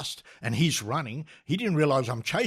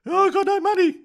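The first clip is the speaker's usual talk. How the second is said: shrieked high, loud plea; mock screaming; 9 dB louder than usual. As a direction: imitate the terrified passenger squealing at full pitch. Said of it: "he started yelling and screaming and yelling".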